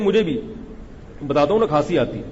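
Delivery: normal talking voice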